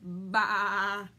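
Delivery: loud, held sheep bleat; sheep impression. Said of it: "Do your best sheep impression".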